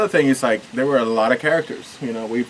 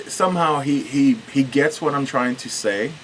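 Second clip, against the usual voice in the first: ordinary delivery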